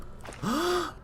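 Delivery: high gasping breath; gasp for air. Pretend. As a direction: suck in air like surfacing. Surfacing for air in game.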